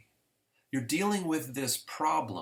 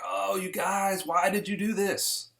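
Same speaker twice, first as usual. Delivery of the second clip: disappointed God; 8 semitones higher, 4 dB louder than usual. Retold or imitated. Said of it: "and God's like".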